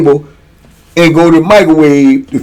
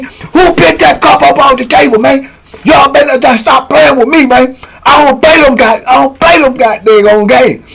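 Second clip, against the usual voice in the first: high, toothless mock-angry rant; deadly serious outburst; 8 semitones higher than usual. Inmate warning youngins to stop playing, deadly serious. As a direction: imitate an inmate who means every word, pitched way up. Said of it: "Because he talking like this, but he deadly serious".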